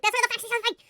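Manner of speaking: loud, high burst of delight; excited outburst. Real, not acted. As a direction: burst out as you spot it. Interrupts self on spotting book.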